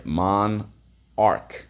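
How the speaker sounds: "Monarch" split into mon, pause, ark; "Monarch" in separate syllables for spelling. Shown as a demonstration. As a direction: sound out "Monarch" slowly in two beats. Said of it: "So let's go".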